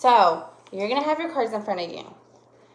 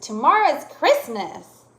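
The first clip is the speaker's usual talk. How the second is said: higher, louder excited statement; excited exclamation; 4 semitones higher, 6 dB louder than usual. Shown as a demonstration. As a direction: exclaim it with a falling finish. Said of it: "No, I said".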